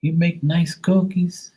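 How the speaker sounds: high, slow, sing-song voice; mock customer. Customer asking Maria for food, in a high, slow voice. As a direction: sweetly ask for food. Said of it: "They're like".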